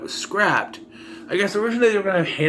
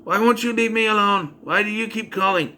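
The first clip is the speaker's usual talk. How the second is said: higher, unvaried line reading; monotone acting; 6 semitones higher than usual. Babysitter questioning her phone stalker, monotone. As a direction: recite it like a poor actress, every line alike. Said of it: "It's very monotone".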